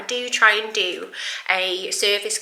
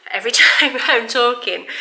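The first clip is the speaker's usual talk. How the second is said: laughing; 4 semitones higher, 6 dB louder than usual. Real, not acted. Laughs at own breathlessness.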